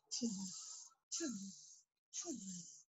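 soft hissing tss tss tss; quiet teasing monkey hiss; three soft mocking tss sounds. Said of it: "and said".

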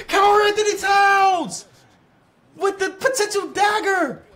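high, loud, slow drawn-out play-by-play call; announcer call. Pretend. Gamer calling his player's big shot like an announcer, hammed-up commentator shout. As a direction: belt it like a TV commentator.

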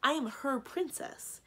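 high, loud, slow insistence; sharp. A narrator's reading. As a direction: state it pointedly, word by word. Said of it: "said sharply".

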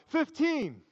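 called out high across the lunch table; yelled number. Retold or imitated. Worker yells a number at lunch, high shout. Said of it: "someone yells out".

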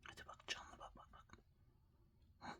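whispered coaxing to a pet; barely voiced, coaxing the animal. Real, not acted.

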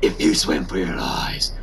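low hushed rasp of a hero; a hint of Batman's gravelly growl; Batman impression. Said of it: "I didn't try Batman, did I?".

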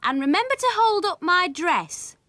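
high, loud bossy-bride voice; sister's voice. A narrator's reading. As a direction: pitch it up and order him about. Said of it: "said Willie's sister".

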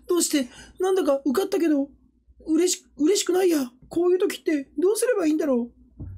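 high-pitched little cartoon character voice; Hachiware. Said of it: "八割れな".

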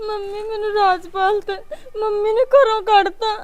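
tearful, sobbing words; crying. Acted. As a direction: weep as you tell her. Thrown out, tells mother weeping.

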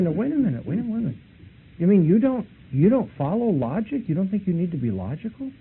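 a mock stunned protest at his claim; incredulous. Incredulous at rejecting logic.

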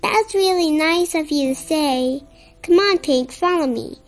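ordinary delivery

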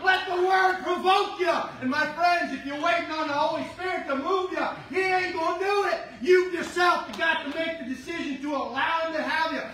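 rally the room to praise out loud; preaching at full force. Acted. Pastor urging the church to praise, shouting.

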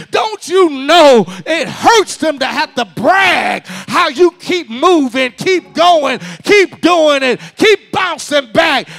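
loud, drawn-out preacher's climax; preaching at full force. Acted. Preacher building to a shout for the church.

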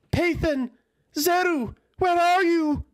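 high, loud desperate calls; calling out. Acted. Aeltha landing at the tent, calling loudly for Pathan. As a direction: shout for them across the camp. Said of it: "began calling".

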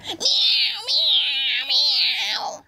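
angry, very high cat yowls; Missus Ginger. A narrator's reading. Cat scratching and beating the girl, angry yowling. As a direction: yowl furiously like an attacking cat. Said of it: "was very angry and scratched".

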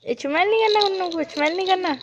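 high, loud warning, rushing at the end; gentle scolding. Real, not acted. Mother warning the child. (ऊँची आवाज़ में हल्की डाँट)

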